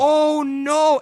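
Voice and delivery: high, loud cry of dismay; exaggerated, drawn-out dismay. Viewer reacting to the heartbreaking scene, dismayed.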